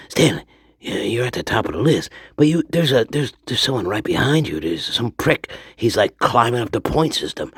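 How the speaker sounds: excited manager. Retold or imitated. Station manager warns Stanley of a rival, urgently. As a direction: rush the bad news to Stanley.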